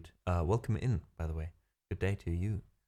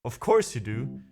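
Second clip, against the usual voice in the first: imitating friend; 5 semitones higher, 4 dB louder than usual. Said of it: "He's like".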